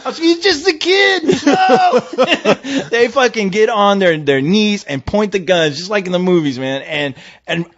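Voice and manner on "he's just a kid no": hammed-up high, drawn-out plea; screaming plea. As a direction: cry out high to stop a shooting. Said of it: "and I'm screaming".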